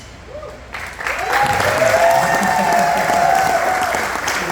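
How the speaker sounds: bright, delighted burst of laughter; happy laugh. Real, not acted. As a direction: laugh out loud with joy. Laughing at her own remark.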